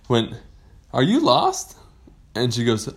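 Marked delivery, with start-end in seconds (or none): 0.9-1.7 high, louder questioning lilt; friendly shark character. Acted. Shark meeting a lost pirate, high curious voice. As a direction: pipe up with a rising question.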